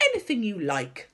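mildly proud assurance; proudly. A narrator's reading. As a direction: boast lightly of your skill. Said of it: "the B F G said proudly".

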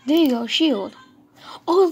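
usual speaking voice

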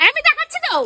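high, loud protest; insistent shout. Acted. Keeping the man from taking over the parcel, insistent shout. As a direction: insist loudly, pitched right up. Fending off his grab at parcel.

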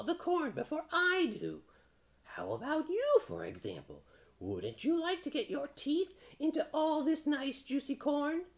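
very high, worried bird; crow voice. A narrator's reading. Crow fretting to the frog over corn, high crow voice.

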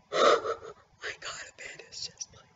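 breathless whisper, slow; real stunned whisper; awed whisper. Real, not acted. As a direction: hush it out in disbelief. Whispering in disbelief after drop.

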